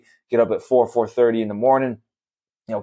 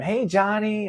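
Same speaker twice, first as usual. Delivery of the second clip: high, sing-out little daughter's hello; Rad; 10 semitones higher, 4 dB quieter, slower than usual. Retold or imitated. Daughter greeting the leaf-blower guy, imitated high child voice. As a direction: imitate a small child greeting someone, much higher. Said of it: "So Rad's talking to him".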